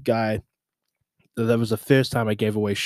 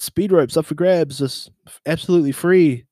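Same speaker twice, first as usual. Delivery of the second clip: louder hawker call, higher pitch; 6 semitones higher, 4 dB louder than usual. Retold or imitated. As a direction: hype up the crowd like a vendor.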